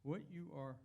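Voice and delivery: ordinary delivery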